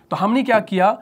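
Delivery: normal talking voice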